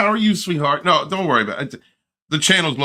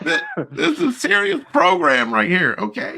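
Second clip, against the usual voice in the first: higher, laughing through fake seriousness; laughing mock scold; 8 semitones higher than usual. Real, not acted. Host scolds the chat, laughing mock seriousness. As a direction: try to sound serious and fail.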